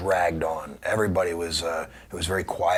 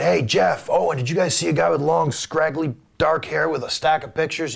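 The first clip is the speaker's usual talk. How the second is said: calling out; 7 semitones higher, 3 dB louder than usual. Retold or imitated. Calling out across the lobby.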